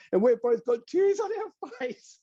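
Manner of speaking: real emotion rising into the voice, then easing as it settles; moved near to tears, high-pitched; tearing up. Real, not acted. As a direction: voice climbing very high, choked up. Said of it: "I'm tearing up now".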